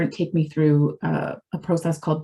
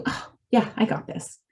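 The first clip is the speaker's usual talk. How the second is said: smug whisper; 4 semitones higher, 4 dB quieter than usual. Retold or imitated. Mimicking her own complacent self-talk.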